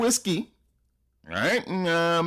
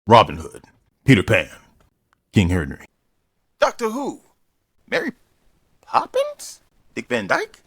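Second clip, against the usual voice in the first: loud low listing; 4 semitones lower, 5 dB louder than usual. Real, not acted.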